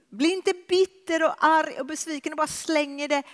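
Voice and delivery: high, loud impassioned preaching; a preacher building to a climax, high and loud; full-force preaching. Acted. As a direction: lift it and drive it home with fervour.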